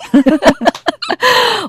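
high loud laugh. Real, not acted. Laughing at how she talked to Jesus. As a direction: burst out laughing. Laughs at her own prayer.

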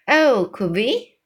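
excited exclamation, stretched and lilting up; eager cry. Acted. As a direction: jump at the offer with a long "Oh" and a rising end. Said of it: "Sophie cried".

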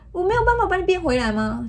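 put-on high innocent child's plea. Mother quoting her daughter's naive question, high and childlike. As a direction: ask it like a hopeful little girl. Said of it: "天真的那个童言童语".

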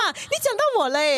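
put-on high, childlike quoting of her daughter; mother imitates her child hearing her name on the podcast; little daughter's voice. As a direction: play the little girl pointing out her name. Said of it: "他就说".

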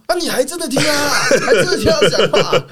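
high, laughing mockery; laughing tease. Teammate laughing at a player for obeying, mocking. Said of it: "博勋就说".

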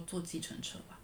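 softly unbothered, a stifled laugh; very calm. Retold or imitated. Telling her panicked boss she'll take a cab, softly calm. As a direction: shrug it off quietly. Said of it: "我就超平静地跟他说".

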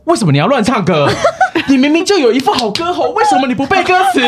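high-pitched scolding, laughing through it; mock angry scolding. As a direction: scold her for singing wrong lyrics while cracking up. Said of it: "然后就非常的生气". (边笑边学他高声责骂)

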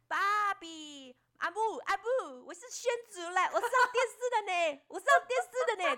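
high, hammed-up Taiwanese accent. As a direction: lay on a thick Taiwanese accent. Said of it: "很想要很台的".

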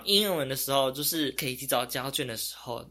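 usual speaking voice

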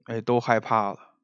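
ordinary talk, nothing marked

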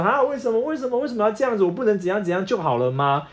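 high, whiny mock protest; imitating a wife wailing over a diet; mock wailing complaint. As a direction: ham up a wife's dramatic whining. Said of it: "她就开始哭天喊地说".

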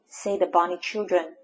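plain reading voice

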